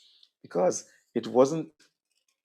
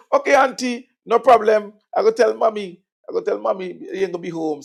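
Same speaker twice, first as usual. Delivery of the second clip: put-on cheerful child; 10 semitones higher, 7 dB louder than usual. Child answers auntie.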